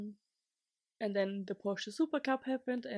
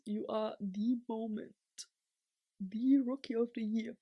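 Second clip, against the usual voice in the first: usual speaking voice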